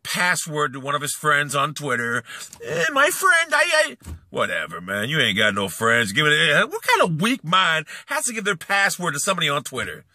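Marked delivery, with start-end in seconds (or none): 2.6-4.0 much higher mock whimper; sneering impression of a helpless rival; mocking whiny voice. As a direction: imitate him pleading feebly. Mocking rival asking a friend.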